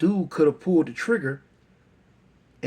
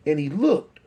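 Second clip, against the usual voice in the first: ordinary delivery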